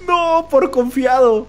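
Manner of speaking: high, loud, drawn-out lament; wailing regret. Real, not acted. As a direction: wail it slowly after the scare. Wailing after being caught. (lamento agudo y alargado)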